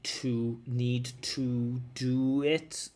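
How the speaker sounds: "to, need to do it" slowly, each word separate and unreduced; word-by-word full forms. Shown as a demonstration. Teacher imitating a careful student's mechanical speech, "need to do it" word by word. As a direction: give every word in "need to do it" its full vowel and equal weight. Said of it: "each word was being pronounced individually".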